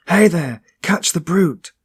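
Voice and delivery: loud, high alarm shout. Acted. Man dashing downstairs shouts after the brute, loud high yell. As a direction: yell it down the stairwell.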